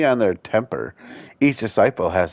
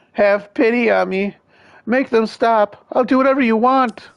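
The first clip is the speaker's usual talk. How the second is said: high loud pleading; terrified begging; 13 semitones higher, 5 dB louder than usual. Acted. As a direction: beg for life in terror. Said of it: "Livid with terror".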